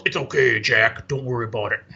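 low wrestler's reassurance; Jesse the Body. Retold or imitated. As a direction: imitate a big wrestler, deep and easy. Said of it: "And the body's like".

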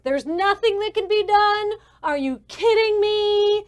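high, loud disbelieving outburst; exasperated yelling. Acted. Man overheard yelling behind a door, exasperated. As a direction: yell it, thoroughly fed up. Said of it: "angry, it's exasperated".

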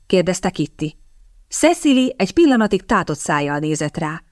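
usual speaking voice

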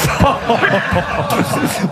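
real, hearty laughing shout; laughter. Real, not acted. Teased by a fellow panellist.

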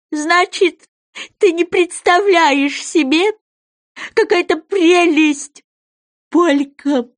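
high, very drawn-out creature voice; rapturous goby. Acted. Goby tells Alice of a lovely dance, rapturous. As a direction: stretch each phrase dreamily, up high.

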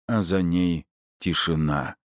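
ordinary delivery

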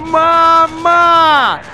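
put-on long, high, drawn-out wail of a dying boy; high anguished cry stretched out long; desperate cry for mama. A narrator's reading. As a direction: call out for your mother in agony. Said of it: "звали одного единственного человека".

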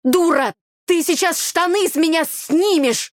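high, loud sibling outrage; indignant yelling. A narrator's reading. Brother losing his shorts to his sister, indignant. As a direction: yell at her to let go.

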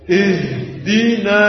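slow "Ihdina" with a heavy, thickened "Ih"; wrong thick ha. Shown as a demonstration. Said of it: "Jangan dibaca dengan tebal".

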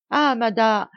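servant bursting out, stumbling over the title; excited cry. Acted. Domestique qui arrive affolé, cri agité. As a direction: call out in a flustered rush. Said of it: "s'écria le domestique".

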